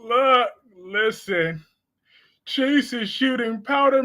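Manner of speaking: ordinary talk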